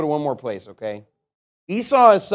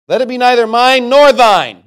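loud, high spiteful cry; raging woman, high and very loud; acted rage; 8 semitones higher, 12 dB louder than usual. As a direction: shout it bitterly at the rival. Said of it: "What a psychopathic rage".

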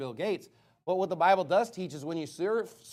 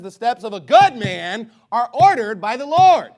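a sudden booming preacher's proclamation, much louder; loud preaching; 5 semitones higher, 13 dB louder than usual. Real, not acted. Preacher booms the verse to his congregation, much louder.

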